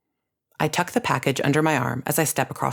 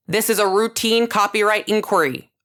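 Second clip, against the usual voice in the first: assuring official voice; 6 semitones higher than usual. A narrator's reading. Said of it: "a different voice assures".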